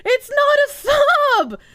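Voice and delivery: slow, high, crying mock wail. Wailing at chat that it's not a sub, mock crying. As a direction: wail it like a fake sob.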